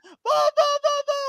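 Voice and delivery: high, loud panicked calls for mom; scared child calling mom. Retold or imitated. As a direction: call for mom in a frantic high voice. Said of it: "she goes like".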